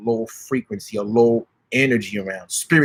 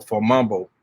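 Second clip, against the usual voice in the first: ordinary delivery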